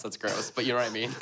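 ordinary delivery

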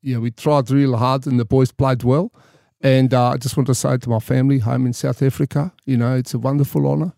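Afrikaner rugby player in an interview; thick Afrikaner accent. Retold or imitated. Man imitating an interviewed rugby player, thick Afrikaner accent. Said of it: "very thick Afrikaner accent".

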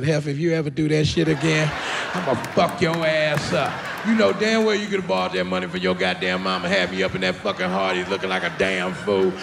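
angry threat. Acted. Uncle hugging niece who gave card, menacing scolding. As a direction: threaten hard, building to an angry tirade.

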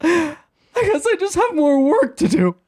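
mock resigned wife. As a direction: parody her sudden cheerful surrender. Crying wife suddenly resigned on show.

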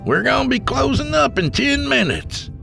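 bartender calling last orders, high and loud; Southern bartender hollering to the room; hollered announcement. A narrator's reading. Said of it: "The bartender hollered to the dozen".

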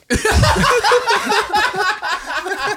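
very high cartoon laugh; vintage cartoon voice laugh. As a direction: squeal like an old cartoon character. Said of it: "I sound like a cartoon character".

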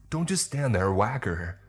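high, frantic command; urgent yelling. A narrator's reading. Manager urging Toby to swing, yelling. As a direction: yell at a dithering helper.

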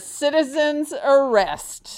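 loud, hammed-up proclamation; mock official announcement. Co-host announcing a citizen's arrest, mock officious declaring.

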